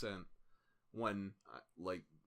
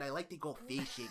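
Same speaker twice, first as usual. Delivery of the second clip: put-on Minnesota accent; 4 dB quieter than usual. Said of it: "That's a Minnesota accent".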